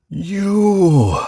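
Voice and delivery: long, high, loud ghost wail; ghostly wail. Acted. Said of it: "it wailed".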